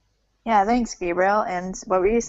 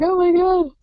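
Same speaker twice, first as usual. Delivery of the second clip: higher, excited mock quote; 8 semitones higher than usual. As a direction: ham up the excitement. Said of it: "'Cause you're like".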